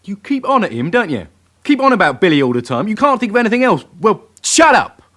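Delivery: rising to a furious shout; angry outburst. Acted. Suspect loses his temper with the sergeant, building to a shout.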